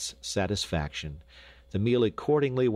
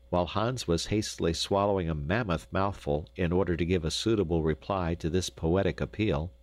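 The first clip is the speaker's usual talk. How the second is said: ordinary delivery, nothing marked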